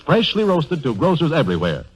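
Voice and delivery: usual speaking voice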